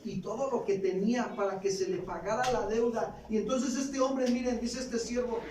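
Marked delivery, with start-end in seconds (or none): none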